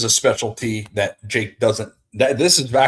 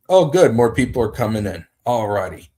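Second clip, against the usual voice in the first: usual speaking voice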